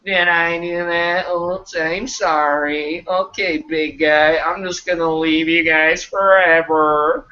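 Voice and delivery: slow, drawn-out sitcom nerd voice; Steve Urkel. Acted. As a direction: imitate the clumsy neighbour's catchphrase apology. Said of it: "he'd do a little Urkel routine".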